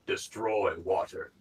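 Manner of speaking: slow, booming voice from the forest; deep voice. Acted. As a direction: intone it slowly like a hidden foe. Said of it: "you hear a deep voice".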